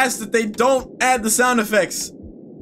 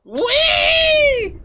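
excited squeal. Acted. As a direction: squeal with joy on the way down. Sliding down the slide again.

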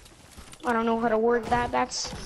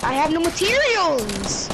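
loud, slow frustrated yell; raging gamer; 5 semitones higher, 4 dB louder, slower than usual. Real, not acted. Gamer raging at lack of mats.

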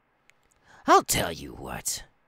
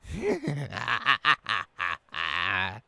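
evil laugh. Acted. Captain laughs before crew.

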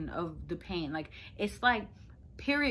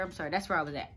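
usual speaking voice

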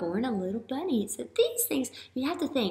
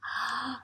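soft gasp; 9 dB quieter than usual. A narrator's reading. Dramatic gasp before question.

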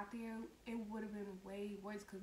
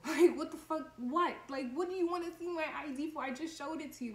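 loud, higher incredulous outburst; indignant retort; 5 semitones higher, 9 dB louder than usual. Retold or imitated. Student retelling her retort to a security guard, indignant and loud. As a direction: retell it as a loud, baffled protest.

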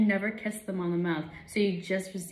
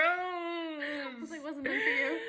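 laughing through the words; giggling while talking; 9 semitones higher, 8 dB quieter, slower than usual. Real, not acted. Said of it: "That's so gross".

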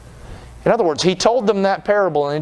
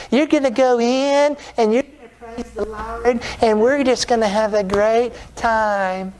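high, slow, syrupy-positive preacher with a lisp; lisping prophet; 7 semitones higher than usual. Acted. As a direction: put on a drawn-out lisping feel-good voice. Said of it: "have a lisp and everything".

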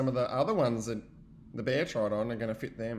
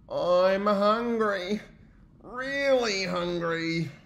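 hungry bear; 7 semitones higher, 7 dB louder, much slower than usual. Acted.

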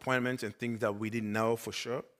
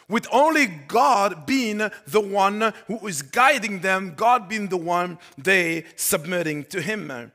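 louder, slow emphatic preaching; 7 semitones higher, 9 dB louder, slower than usual. Acted. Pastor declaring God was their only guide, preaching. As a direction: drive each phrase home, drawn out.

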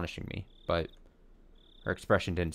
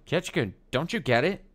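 mildly angry outburst, higher pitched; 8 semitones higher, 4 dB louder than usual. Acted. Rina challenges Keiichi at meeting up, mildly angry. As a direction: press the question with contained anger. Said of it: "Rina shouted, clearly angry".

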